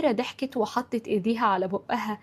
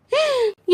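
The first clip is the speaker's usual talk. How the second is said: sharp, high-pitched gasp of alarm; startled gasp; 14 semitones higher, 4 dB louder than usual. A narrator's reading. Mother jumps up realising time ran out, startled gasp. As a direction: gasp as if suddenly jolted up. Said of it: "هدى قامت منطورة".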